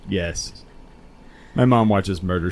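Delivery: ordinary talk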